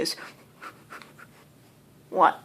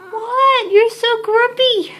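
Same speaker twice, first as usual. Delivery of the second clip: teasing cat talk; 7 semitones higher, 3 dB louder than usual. Real, not acted. Owner teasing her cat Leo for being grumpy, high baby talk. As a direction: tease the grumpy cat fondly.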